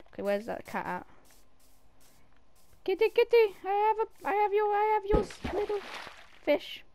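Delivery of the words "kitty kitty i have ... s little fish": cutesy cat call. Real, not acted.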